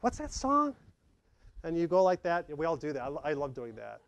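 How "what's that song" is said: curious question. Retold or imitated. Asking aloud about a song in a mall.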